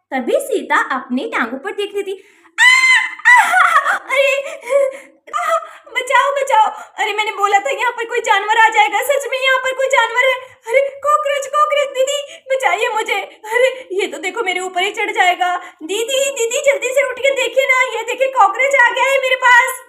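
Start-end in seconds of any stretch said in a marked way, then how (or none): 2.6-6.7 high, loud screaming for help; girl screams in fear, much higher and louder; terrified scream. Acted. Sita wakes screaming at cockroach. 7.0-19.8 high, frantic cries to her sister; frightened girl calling didi, much higher; panicked pleading. Acted. Sita panics, calls sister for help.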